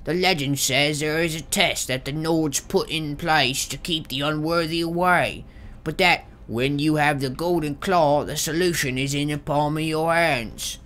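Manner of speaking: high strained voice for Arvel; Arvel journal voice. Pretend. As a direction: read the journal as a nervous thief. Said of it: "what do they sound like?".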